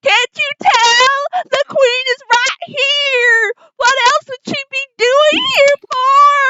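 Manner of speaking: very high, loud, drawn-out wail; hysterical accusation. Acted. Revived lady blaming the queen, hysterical wailing. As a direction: keep up the frantic, wailing accusation. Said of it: "and then she's like".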